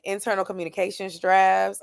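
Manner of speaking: ordinary talk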